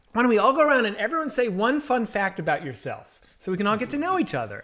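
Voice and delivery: mock the cheerful actress icebreaker; vapid actress. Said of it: "She goes".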